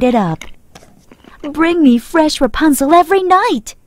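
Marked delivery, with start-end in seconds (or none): 1.4-3.8 bright, higher happy demand. Acted. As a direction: ask with delight. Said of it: "she said happily".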